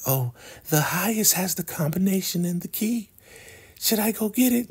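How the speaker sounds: very high mock-female falsetto; imitating a sweet-talking woman; flirty woman. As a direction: offer it coyly in a sweet falsetto. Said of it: "we feel this sexual tension".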